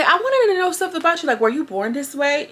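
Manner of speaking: high, excited imitation of Bobby; mimicking Bobby. Vlogger voices Bobby's question.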